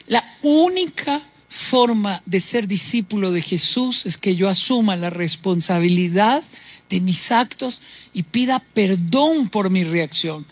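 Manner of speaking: ordinary talk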